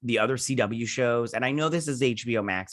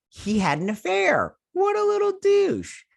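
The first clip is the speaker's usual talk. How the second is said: very high, drawn-out squeal; squealed mock outrage; 5 dB louder than usual. Reviewer revealing an affair to viewers, squealing in mock outrage. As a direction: climb into a slow, silly falsetto. Blurts scandal in put-on high voice.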